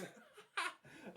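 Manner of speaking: high laughing burst; burst of laughter. Real, not acted. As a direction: break out laughing. Laughing at the guy.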